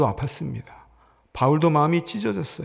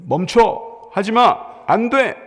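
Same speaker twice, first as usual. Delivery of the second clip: sharp, loud, rising commands; alarmed shouts; 5 semitones higher, 5 dB louder, much slower than usual. Shown as a demonstration. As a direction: bark the warnings in fear. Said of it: "이렇게 들려요".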